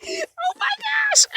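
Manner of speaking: squealing oh my gosh through tears of laughter; high cry-laugh, stretched out. Real, not acted. Laughing so hard at the memory she squeals high. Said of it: "I got me crying".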